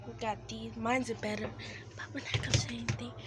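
fast whispered aside; whispering. Real, not acted. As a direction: whisper it so nobody hears. Secret aside about shoes.

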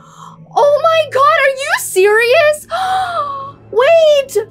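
high, loud distraught wailing; real horror at the death; screaming and crying. Real, not acted. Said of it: "I was, like, screaming and crying".

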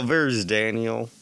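usual speaking voice

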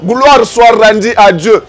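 usual speaking voice

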